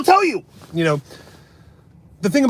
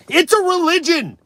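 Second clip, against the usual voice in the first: mocking high voice; 13 semitones higher than usual. Mocking vegans.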